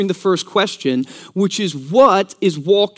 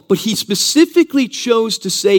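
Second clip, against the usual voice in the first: ordinary talk, nothing marked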